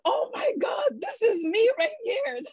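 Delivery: high tearful shout of recognition; screaming and crying. Retold or imitated. Finally recognising herself in a YouTube video, a high tearful scream. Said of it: "And I was screaming".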